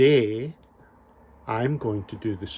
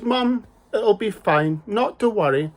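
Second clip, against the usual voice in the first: put-on breezy little girl; 8 semitones higher than usual. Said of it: "and she says".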